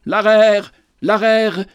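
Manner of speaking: a prophet's sudden loud cry; clamoring. A narrator's reading. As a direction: burst out with L'arère as if waking from a dream. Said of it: "il clama tout à coup".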